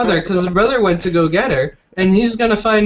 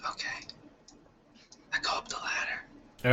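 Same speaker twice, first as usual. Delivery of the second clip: whispering; 15 dB quieter than usual. Acted. Player announces climbing the ladder, whispering. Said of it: "I don't know why I'm still whispering".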